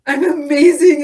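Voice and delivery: loud, pushed from the belly; loud tearful declaration. Acted. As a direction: push it down into the belly. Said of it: "push that statement down into your belly center".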